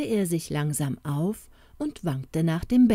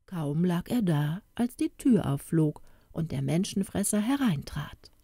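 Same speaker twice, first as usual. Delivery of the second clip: plain reading voice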